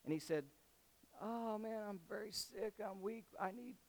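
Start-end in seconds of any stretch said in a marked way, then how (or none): 1.2-3.8 fake sick moan. Amnon fakes being sick.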